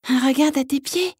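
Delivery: high tiny frog voice; small high froggy voice; little voice. Acted. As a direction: speak as a tiny frog calling. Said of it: "dit la petite voix".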